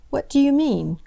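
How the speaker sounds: eager high question; urgent question. A narrator's reading. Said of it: "She really wanted to know".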